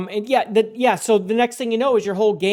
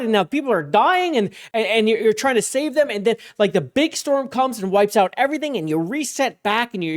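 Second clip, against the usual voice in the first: ordinary talk, nothing marked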